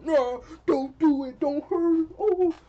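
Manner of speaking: high squeaky mock plea from a bystander; mock brave bystander. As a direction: imitate a timid hero begging her to stop. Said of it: "some brave dude wanted to pull up".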